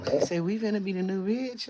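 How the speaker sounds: normal talking voice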